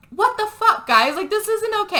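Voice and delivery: high-pitched mock outrage; retold indignation. Angry friend reacts to the dare card, high mock outrage. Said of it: "so he's mad".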